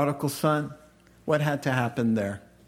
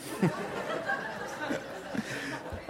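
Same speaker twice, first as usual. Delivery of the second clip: laugh; 11 semitones higher, 10 dB quieter than usual. Real, not acted. Preacher laughs at own joke.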